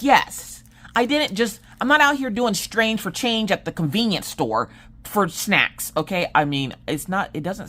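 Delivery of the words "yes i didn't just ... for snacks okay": higher, emphatic protest; indignant. Retold or imitated. Mom protesting to her husband on speakerphone, indignant and higher. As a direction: set the record straight, offended. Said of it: "I'm like".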